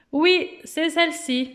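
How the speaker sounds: ordinary talk, nothing marked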